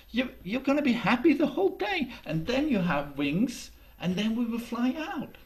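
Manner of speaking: put-on high, quick girl voice, eager; bubbly young girl promising; Janie's voice. Janie cheers Jakob up about flying, high eager girl.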